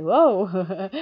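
loud, high exclamation breaking into laughter; startled whoa. Retold or imitated. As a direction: burst out with an amused, high whoa. Reacts aloud to word just read.

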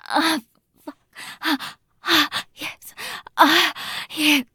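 loud breathy moans; moaning. Acted. Moaning during sex.

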